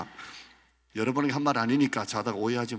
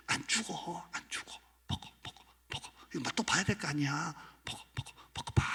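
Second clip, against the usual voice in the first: hushed devilish whisper; tempting whisper; 5 semitones higher, 6 dB quieter than usual. Acted. Devil whispering to Eve to eat. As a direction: whisper it into her ear, insistent.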